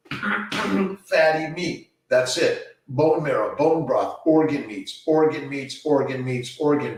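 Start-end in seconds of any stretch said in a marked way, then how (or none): 0.1-1.9 "Fatty meat" drawn out very slowly and higher; slow emphatic. Shown as a demonstration. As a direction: say "Fatty meat" slowly, every word weighted.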